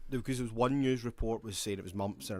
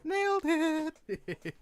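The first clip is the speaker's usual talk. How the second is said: mock falsetto; much slower than usual. Mock-triumphant echo.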